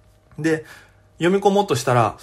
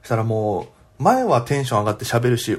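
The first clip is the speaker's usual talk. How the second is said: normal talking voice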